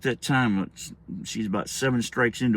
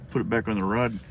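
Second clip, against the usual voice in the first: plain reading voice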